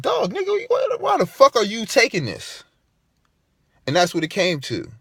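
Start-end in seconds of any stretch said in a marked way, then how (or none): 0.0-2.6 high, disbelieving mock friend; incredulous high voice. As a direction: squeak it out like a stunned buddy. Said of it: "it's like".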